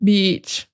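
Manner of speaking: "Beach" with a drawn-out E; long E. Shown as a demonstration. Teacher modelling a long "Beach" for learners. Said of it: "you gotta do it longer".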